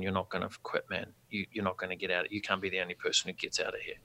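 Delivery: soft inner voice urging himself on; quiet self-talk. Retold or imitated. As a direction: talk yourself through it under your breath. Inner pep talk in sweat lodge.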